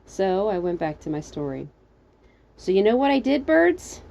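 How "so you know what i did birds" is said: higher, louder question climbing at the end; calling up. Acted. Narrator to the birds above, calling up. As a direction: call up to the birds, rising.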